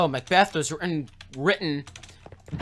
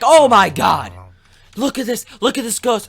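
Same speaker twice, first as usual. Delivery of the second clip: high, loud mock alarm; panicked outcry; 6 semitones higher, 10 dB louder than usual. Macbeth pointing out a ghost, panicked outcry. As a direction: shout it in hammed-up fright.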